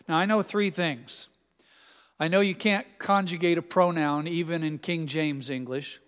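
ordinary talk, nothing marked

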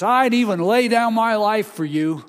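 loud, high boast; Peter's loud, proud promise to Jesus; boastful vow. Retold or imitated. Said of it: "he told Jesus".

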